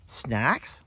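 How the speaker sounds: high, slow, curious donkey voice; voicing Beverly the donkey. Owner voicing a donkey noticing Dan heading for snacks, high and curious. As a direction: wonder aloud like a donkey spotting snacks. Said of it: "Beverly like".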